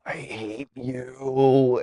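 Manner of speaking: slow, drawn-out mock sob; hammed-up tearful I hate you. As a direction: whine it out like a crying kid. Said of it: "I'm like".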